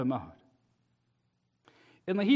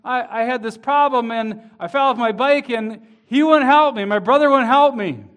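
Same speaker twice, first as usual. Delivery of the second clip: put-on louder, higher tattling-kid complaint; complaining child; 5 semitones higher, 7 dB louder than usual. Child complaining to mom and dad about a brother, tattling whine. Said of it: "a child ... going to dad and mom and saying".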